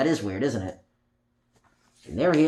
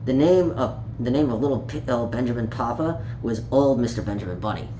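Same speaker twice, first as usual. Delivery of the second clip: put-on reading a picture book aloud to children; gentle storytime read, page by page; story narration; 4 semitones lower than usual. A narrator's reading. Storyteller reading Peter Rabbit to young listeners, gently.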